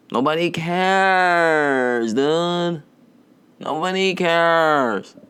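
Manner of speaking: high, slow, stretched-out mocking voice; mock drawn-out voice. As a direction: draw out nobody cares in a silly put-on voice. Mocking drawn-out repeat of phrase.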